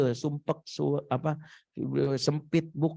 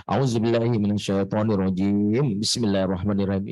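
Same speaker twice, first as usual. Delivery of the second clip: mock ruqyah recitation; 6 semitones lower than usual. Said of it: "Kita langsung keluar ayat".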